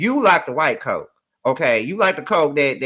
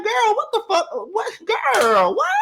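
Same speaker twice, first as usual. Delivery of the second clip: much higher, incredulous shriek of disbelief; stunned disbelief. Retold or imitated. Reacting to Karma's outburst, much higher disbelieving squeal. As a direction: squeal it like you can't believe your eyes. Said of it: "I'm like".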